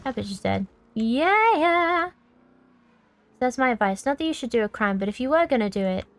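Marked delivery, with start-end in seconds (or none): 0.9-2.1 high gleeful whoop; excited cheer. Real, not acted.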